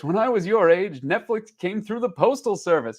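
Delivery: a hint of a grumbling grandfather; grandpa voice. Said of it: "in a grandpa voice".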